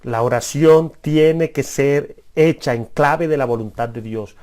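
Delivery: slow, word by word for note-taking; dictating slowly to the congregation; slow dictation. Shown as a demonstration. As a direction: spell it out slowly so they can write it down. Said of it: "Anótalo".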